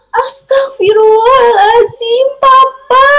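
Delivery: high anguished gasp of shock; shocked cry. Acted. Mother hears on the phone her husband is dying, crying out. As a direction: cry out as the news lands. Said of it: "kata ibu itu".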